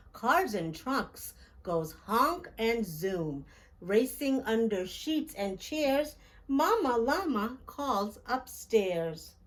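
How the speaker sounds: plain reading voice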